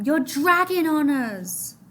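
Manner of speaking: loud, slow angry shout; woman yelling from the boat. Acted. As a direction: push him off with your voice. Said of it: "a woman in the dinghy yelled down at him".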